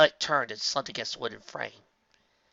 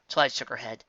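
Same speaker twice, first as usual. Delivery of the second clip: usual speaking voice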